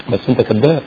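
dropped to a low, soft aside; hushed secret verdict; quiet inner thought. Shown as a demonstration. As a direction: say it under your breath, as a private thought. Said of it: "بس من جوه قوي قوي".

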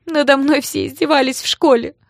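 high, loud, upset wail; tearful. A narrator's reading. Гоша bursts into tears about school bullying, tearful. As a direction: complain through tears. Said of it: "расплакался".

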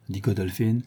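plain reading voice

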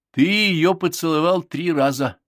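loud, high, slow accusation; stern. A narrator's reading. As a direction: spell out the offence sternly. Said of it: "строго сказала Бет".

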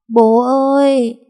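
tiếng gọi cao, to, kéo dài rồi vỡ òa; gọi bố trong nước mắt; òa khóc. A narrator's reading. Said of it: "Con bé òa khóc". (bé gái òa khóc gọi bố qua điện thoại)

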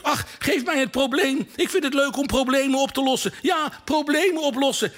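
put-on high, pleading little boy; eager boy voice. A narrator's reading. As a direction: beg like a kid who loves puzzles. Young Mark begging for the problem.

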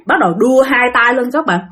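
plain reading voice

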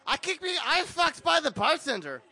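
put-on high, slurred drunk protest; hammed-up drunk complaint. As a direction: wail it out slowly like someone drunk. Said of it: "your accent is drunk".

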